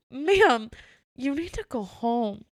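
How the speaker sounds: high, loud retold plea, slowing down; firm insistence. Manager telling guest with covid to go home, firmly insistent.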